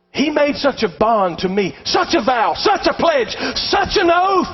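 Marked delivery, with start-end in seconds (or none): 1.8-4.5 building emphasis. Real, not acted. Building list of promises.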